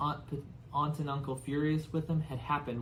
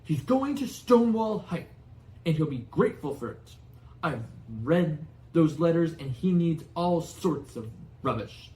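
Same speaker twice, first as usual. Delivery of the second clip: high loud angry rant; 4 semitones higher, 6 dB louder than usual. Acted. Uncle Vernon ranting about Harry's school, high loud fury. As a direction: rant in outrage.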